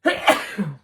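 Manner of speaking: a sudden, loud real cough; coughing fit. Real, not acted. Reader coughs loudly, then apologises to someone nearby. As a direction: cough hard, breaking off the reading. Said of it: "Excuse me, sweetie".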